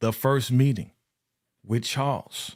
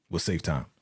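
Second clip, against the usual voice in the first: ordinary talk, nothing marked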